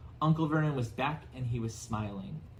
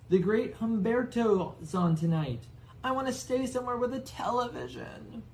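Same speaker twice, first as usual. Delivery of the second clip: whiny Dudley; 11 semitones higher, 4 dB louder than usual. Acted. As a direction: drag it out like a spoiled boy. Dudley demands television.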